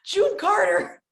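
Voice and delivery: high, slow excited laugh-talk. Real, not acted. Telling the host about the recording, excited. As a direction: gush it up high. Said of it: "laughing high excitement".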